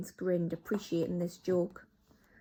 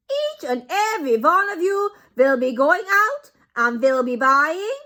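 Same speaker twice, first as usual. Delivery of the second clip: put-on much higher, loud accented voice; Grand High Witch; 10 semitones higher, 13 dB louder than usual. Grand High Witch continues orders, loud accented. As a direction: carry on instructing.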